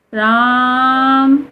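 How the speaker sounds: राम with the vowel held very long; drawn-out Ram. Shown as a demonstration. Teacher demonstrating a long pluta राम. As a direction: stretch राम like a call. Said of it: "इनको इस तरीके से बोला जाता है".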